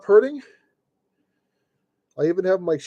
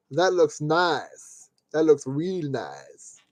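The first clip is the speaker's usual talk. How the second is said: pleased admiration; 5 semitones lower, 5 dB quieter, much slower than usual. Real, not acted. Admiring finished model.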